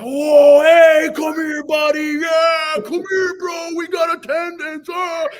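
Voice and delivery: high, loud mock-jock shouting; hammed-up seniors hollering at a kid; rowdy seniors. Seniors in class calling the freshman in, rowdy shouting. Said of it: "the whole class is like".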